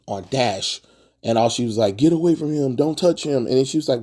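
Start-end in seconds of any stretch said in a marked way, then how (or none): 2.0-3.5 higher-pitched retold freak-out, only mildly heated. Vampirella warns Dash off the baby, mildly freaking out. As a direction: lightly act out a protective panic. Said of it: "Vampirella freaks out on".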